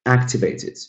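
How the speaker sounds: "activated" with AC stressed; first-syllable stress. Shown as a demonstration. Teacher's four-syllable example, first-syllable stress on "activated". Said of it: "The first one".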